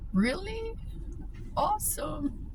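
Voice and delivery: high-pitched, pleased disbelief; soft retold squeal of happy surprise; delighted surprise. Borrower hearing the librarian can look card up, delighted surprise.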